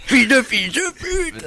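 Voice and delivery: high slow mimic; imitating. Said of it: "Le petit rire". (imitation moqueuse)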